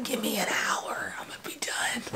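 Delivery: hushed, voiceless whisper. Retold or imitated. Child at the window answering a friend mid-chores, acted whisper. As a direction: whisper it secretly to a friend outside. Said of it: "looking out the window like".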